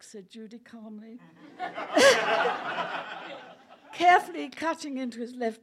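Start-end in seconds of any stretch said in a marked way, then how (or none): 1.3-3.9 unvoiced laugh; suppressed giggle; breathy laugh. Real, not acted. Reader giggling at the scene.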